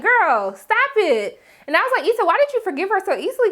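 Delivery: put-on incredulous girl talk. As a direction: scold her like a bestie who can't believe it.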